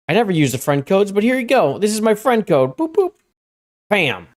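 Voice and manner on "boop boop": playful boop. Real, not acted. Playful sound while sharing code.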